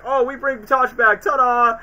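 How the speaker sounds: high, slow showy reveal; mock ta-da. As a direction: present it like a magic trick. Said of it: "it's like".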